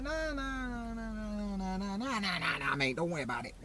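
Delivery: high, quiet string of nahs sliding down; mock dismissal. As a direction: imitate someone breezily waving off a worry. Acting out hospital waving away a test.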